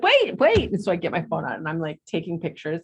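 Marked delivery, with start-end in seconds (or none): 0.0-0.7 high, loud urgent wait; excited call. Retold or imitated. Mom stopping her family to grab photos, high eager call. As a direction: stop everyone, high and eager.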